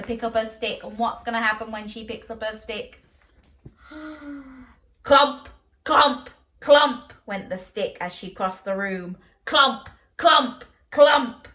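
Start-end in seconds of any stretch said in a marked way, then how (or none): 3.9-4.6 hushed storyteller gasp; soft dramatic gasp. Acted. 5.0-7.1 loud, high thumping sound words; loud clumping. A narrator's reading. 9.4-11.5 loud, high thumping sound words; loud clumping. A narrator's reading.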